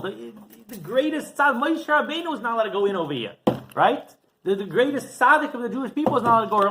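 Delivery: high-pitched, insistent acted lament; impassioned appeal, pitched well above normal talk; distressed protest. Weeping rabbi's protest, acted.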